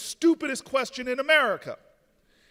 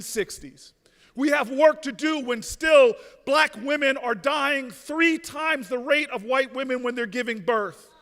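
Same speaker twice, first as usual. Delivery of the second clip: louder, pounding repeated refrains; preaching at full force; 6 dB louder than usual. Acted. Mayor urging the crowd to organize, preaching at full force. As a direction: drive each refrain home to the crowd. Rally call to action.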